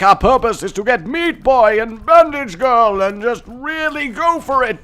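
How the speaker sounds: high, loud, hyped-up declaration; rally the troops with mock gusto; mock rallying cry. Gamer announcing the run's purpose, mock rallying cry.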